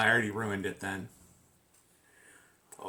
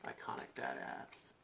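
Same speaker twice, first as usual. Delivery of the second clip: whisper; 12 semitones higher, 15 dB quieter than usual. Pretend. Whispered dramatic tagline.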